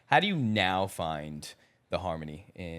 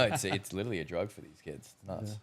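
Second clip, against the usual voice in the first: ordinary talk, nothing marked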